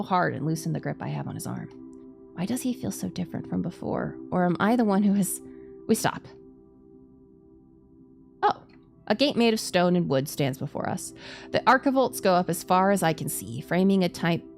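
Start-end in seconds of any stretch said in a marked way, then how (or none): none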